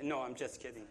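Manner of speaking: normal talking voice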